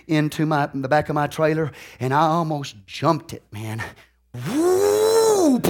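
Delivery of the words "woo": whoop. Retold or imitated. Recalls almost wrecking the tractor.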